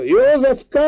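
high, loud drawn-out call of a name; drawn-out shout. A narrator's reading. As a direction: call it out loudly, stretching the words. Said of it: "elnyújtva kiáltotta". (elnyújtott, hangos kiáltás)

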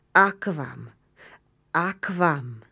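moaned Latin plea, falling; a weak groaning call for water; whimpering in Latin. A narrator's reading. Blinded Roman moaning for water in Latin. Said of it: "latinul nyöszörgött".

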